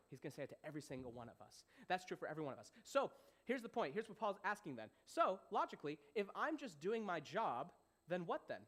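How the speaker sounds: normal talking voice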